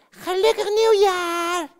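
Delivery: high, slow, drawn-out ghost wail; a hint of a ghost's wail; ghostly voice. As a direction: moan the greeting like a spook. Ghost greets again.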